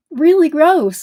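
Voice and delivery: high, loud grossed-out cry; disgusted outburst about the aphids. Real, not acted. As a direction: recoil at the bugs.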